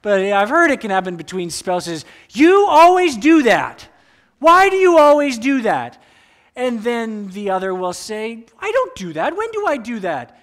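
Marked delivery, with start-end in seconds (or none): 2.3-6.0 hammed-up exasperated accusation at a partner; mock spouse accusation. Acted spouse quarrel, accusing. 8.6-10.4 high, indignant imitated denial; mock defensive spouse. Other spouse defending, acted.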